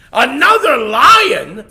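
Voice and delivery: loud, high, slow disbelieving roar; king can't believe a rival exists; outraged lion. Acted.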